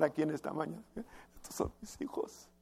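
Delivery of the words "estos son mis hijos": put-on soft, whispered old patriarch; Abraham's voice. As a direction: breathe it out in a hushed whisper, like Abraham presenting his children. Abraham voiced proudly presenting congregation. (Abraham presentando a sus hijos, susurrado)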